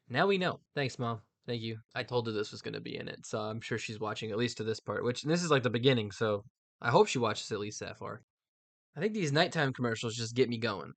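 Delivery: ordinary delivery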